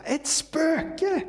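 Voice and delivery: high frightened shriek of ghost; terrified cry. Acted. Disciples in the storm see a ghost, shrieking high in fear. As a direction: cry out in sudden terror, pitched high. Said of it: "de skriker av rädsla".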